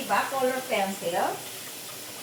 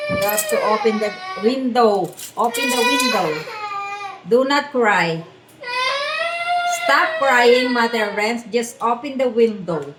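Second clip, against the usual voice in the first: loud, firm parental orders; scolding; 8 dB louder than usual. Real, not acted. Mother ordering crying child to open window, scolding loudly.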